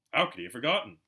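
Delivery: ordinary delivery, nothing marked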